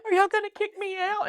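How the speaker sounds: high, loud, wailing sob voice; mock bawling. Telling the lady at orientation she can't do this, mock bawling. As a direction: whimper it out like a panicked freshman. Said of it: "I'm cr-, bawling".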